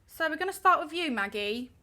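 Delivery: slipping into a light British accent; casual, accidental British accent. Real, not acted. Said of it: "breaking out into a British accent".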